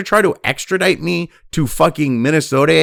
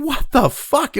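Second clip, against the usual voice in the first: ordinary delivery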